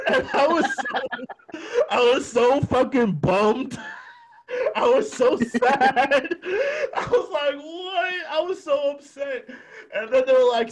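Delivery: high, squealing through real laughter; laughing hard. Real, not acted. Friend recalling a cruel birthday prank, laughing hard. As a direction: crack up while drawing the words out. Cracking up recalling her own disappointment.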